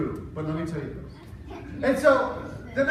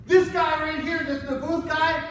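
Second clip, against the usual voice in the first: higher, louder accusation; accusing yell; 7 semitones higher, 5 dB louder than usual. Acted. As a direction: point him out and shout him down. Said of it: "they started yelling things like".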